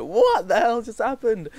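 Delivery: high, loud, slowed cry of bewildered shock; upset disbelief. Retold or imitated. Retelling crying alone after being spat on, high distressed disbelief. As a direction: replay the tearful shock in the toilets. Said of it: "freaking crying because I was like".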